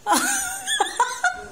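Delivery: sudden high giggle; high burst of laughter. Real, not acted. Mother laughs at child's answer. (taas nga katawa)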